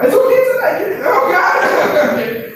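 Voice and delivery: high, loud, laughing outburst; amused, high-pitched teasing; laughing exclamation. Real, not acted. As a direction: squeal it through laughter. Teasing audience about horror, laughing.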